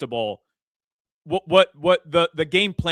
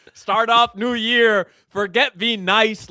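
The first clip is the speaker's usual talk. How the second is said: slow, higher and louder hype; pumped celebration; excited; 7 semitones higher, 5 dB louder, slower than usual. Real, not acted. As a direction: savour every word of the win. Celebrating the win.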